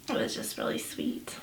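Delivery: almost breathless whisper of tenderness; real soft whisper. Real, not acted. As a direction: drop to a hush as the memory hits. Tender memory of husband's care.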